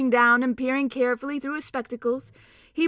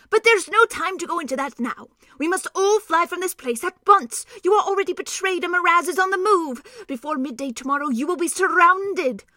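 higher, louder, breathless urgency; urgent warning; 7 semitones higher, 6 dB louder than usual. Acted. Doctor warning the king to flee, urgent and raised. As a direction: press the alarm home.

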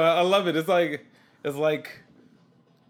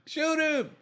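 high, deadpan command; flat movie order. Retold or imitated. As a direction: bark it like a bad movie cue. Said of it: "I just have to tell him every time".

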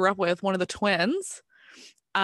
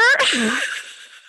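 loud high laugh; real loud laugh; burst of laughter; 8 semitones higher, 7 dB louder than usual. Real, not acted. Laughing at own story.

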